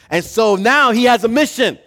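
loud, high, mounting excitement; excited storytelling. A narrator's reading. Preacher telling the congregation of a treasure hunt, excited. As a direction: tell it like a thrilling quest.

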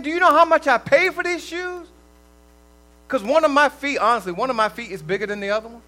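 loud, high-pitched outrage, hammed up; mock indignation. Preacher acting out someone fussing over a stepped-on shoe, mock indignant. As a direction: play an exasperated complaint at rising volume and pitch.

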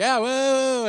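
high, loud burst of joy; excited whoa. Retold or imitated. Banker celebrates the glowing review, loud and high. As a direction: cheer like you just nailed the deal. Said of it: "I'm like".

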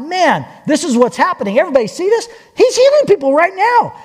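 excited imitation of children calling out; children crying out. Children exclaiming over the healings, excited crying out. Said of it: "they're crying out, they're singing out".

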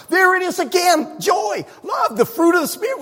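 high exclaiming shout; excited shout. Real, not acted. Preacher exclaiming to congregation, high excited shout. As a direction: cry it out in triumph. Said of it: "preacher exclaiming fruit answer".

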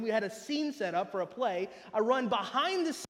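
usual speaking voice

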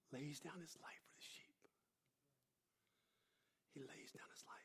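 hushed whisper of the verse. Acted. Preacher hushing the congregation with a whispered verse. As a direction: breathe it out, nearly silent. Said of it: "whispered scripture line".

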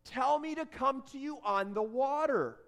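high, urgent call across the water; yelling back. Acted. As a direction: yell back to someone far off, peaking on the name. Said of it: "Exhausted and terrified, Peter yells back".

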